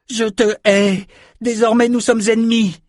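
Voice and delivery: put-on high, furious young girl; hateful declaration. A narrator's reading. Samantha turns to Richard before fleeing, high hateful cry. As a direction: fling it back at a high pitch.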